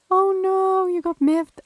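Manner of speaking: loud, high oh no; loud excited yelp. Real, not acted. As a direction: burst out loud in dismay. Reacting to game event.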